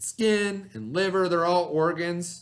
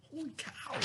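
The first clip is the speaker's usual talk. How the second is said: mock amazement; 11 semitones higher, 17 dB quieter than usual. Teacher's mock amazement at miracle.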